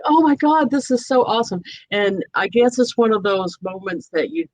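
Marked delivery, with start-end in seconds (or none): 0.1-1.6 awed excitement. Retold or imitated. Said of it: "'Cause it was like".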